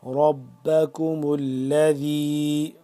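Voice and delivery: ربکم with a thick, full را; heavy ra. Shown as a demonstration. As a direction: open the mouth wide on the را of ربکم, chanted slowly. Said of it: "را کو موٹا کر کے پڑھیں گے".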